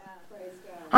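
ordinary talk

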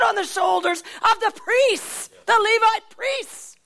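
rising to a shout at the loops and poles; God's stern rebuke. Acted. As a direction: thunder it down as an indignant God. God rebukes David over the ark.

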